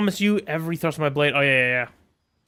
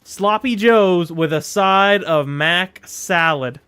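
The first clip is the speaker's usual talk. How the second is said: slow and a bit louder, every word clear; slow clear repeat; 4 dB louder, slower than usual. Shown as a demonstration. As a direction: say it again slowly so they finally hear. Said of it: "I didn't hear you".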